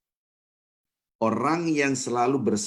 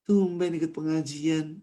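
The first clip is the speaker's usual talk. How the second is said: mock greeting; 7 semitones higher, slower than usual. Someone greets the newcomer.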